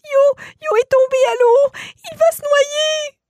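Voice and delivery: put-on high, loud, crying child's voice; sobbing child. Boy telling his father the gnome will drown, sobbing. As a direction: wail it through tears, desperate. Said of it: "entre deux sanglots".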